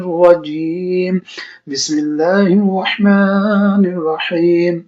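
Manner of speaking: slow, measured Arabic chant; Quranic recitation. Acted. Teacher opens the lesson with slow Arabic recitation. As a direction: recite the opening invocation slowly, like prayer.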